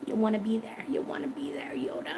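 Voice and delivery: teasing whisper. Shown as a demonstration. Vlogger whispering to viewers about fried ice cream. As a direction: tempt them with a whisper.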